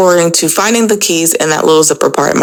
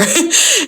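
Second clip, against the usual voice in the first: laugh; 13 semitones higher than usual. Real, not acted. Laughing after the quote.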